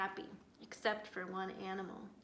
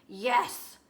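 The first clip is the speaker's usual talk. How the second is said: growl; 10 dB louder than usual. A narrator's reading. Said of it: "Bear growled".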